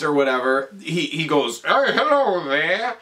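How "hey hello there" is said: long drawn-out mock greeting; slow, stretched-out imitation of an odd figure; imitated pianist character.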